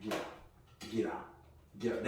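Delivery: whispered repeated get out; whispering. Retold or imitated. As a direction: hiss it under your breath. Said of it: "I'm like".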